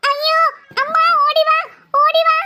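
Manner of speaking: high, alarmed calling out; panicked cry for help. Acted. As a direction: call for mother in acted panic. Frightened girl calls mother for help.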